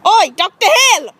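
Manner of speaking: high, loud attention-getting call. Acted. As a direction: shout to get the doctor's attention. Calling the unresponsive doctor.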